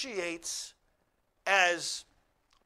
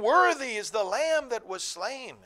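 heavenly acclamation; 4 semitones higher, 7 dB louder than usual. Acted. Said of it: "and saying".

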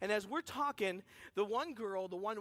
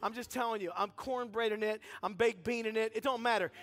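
usual speaking voice

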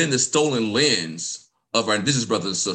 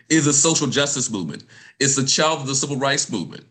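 normal talking voice

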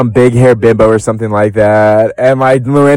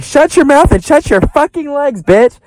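shrill mock yelling. Tinsley quoted yelling at Sonya.

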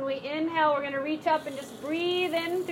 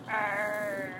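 Popeye growl; 6 semitones lower, 5 dB quieter than usual. Acted. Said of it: "It's like Popeye".

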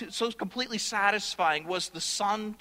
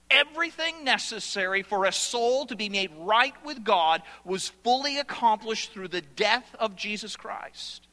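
ordinary talk

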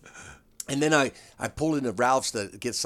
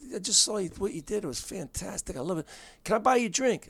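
higher, softer gushing compliment; eager fan; 8 semitones higher, 3 dB quieter than usual. Retold or imitated. Fan at the Improv bar praising the comic, higher softer gushing. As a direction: gush over the show, lightly.

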